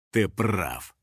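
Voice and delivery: low-pitched measured agreement; conceding villain. A narrator's reading. Hunter agreeing with his son, low and measured. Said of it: "кивнул Юджин".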